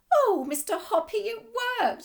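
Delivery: high, loud, tearful cry of relief; crying with joy. Acted. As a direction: sob it out, overjoyed. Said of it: "She was crying".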